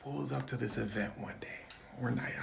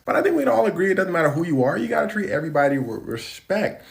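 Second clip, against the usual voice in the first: emphatic; 4 semitones higher, 10 dB louder than usual. Real, not acted. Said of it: "moral of the story".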